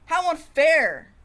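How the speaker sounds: outraged thought. Acted.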